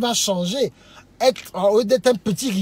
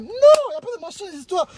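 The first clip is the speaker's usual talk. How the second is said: high raised exclamation of refusal; emphatic protest; 7 semitones higher, 5 dB louder than usual. Shown as a demonstration. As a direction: cry out against it in exasperation. Emphatic rejection of bad behaviour.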